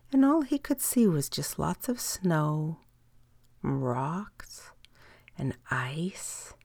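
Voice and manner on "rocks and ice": hushed storyteller whisper. A narrator's reading. Narrator whispering the cold summit to a child. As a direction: breathe the words softly. Lonely snowy summit described.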